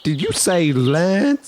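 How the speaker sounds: high, slow, drawn-out disbelief; incredulous question. Acted. Castmate questioning the soldier's confession, incredulous. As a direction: stretch the question out in high mock shock.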